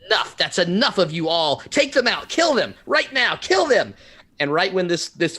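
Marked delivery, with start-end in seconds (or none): 0.1-3.9 higher, ordering the guards to kill; commanding order. Acted. Savik orders his guards to attack them.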